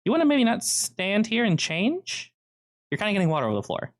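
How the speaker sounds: hint snarkily in a high character voice; high-voiced employee nudging a customer away; snarky girl employee. Game master voices the girl.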